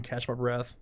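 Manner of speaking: usual speaking voice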